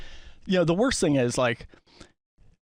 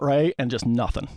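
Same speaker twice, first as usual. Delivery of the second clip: normal talking voice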